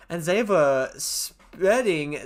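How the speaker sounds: mock German accent on spreading; "spreading" said as "sppɛdɪng" in a German accent. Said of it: "stop doing my German accent".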